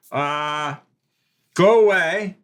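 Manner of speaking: loud, high shout; calling out. Acted. Lookout sends the visitors away, calling out. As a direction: shout them off from a distance.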